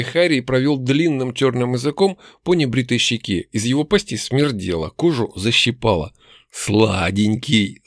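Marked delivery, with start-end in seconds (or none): 6.5-7.8 very slow, low orc growl; growling brute. A narrator's reading. Orc slobbering over his captive, slow low growl. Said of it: "рыкнул громила".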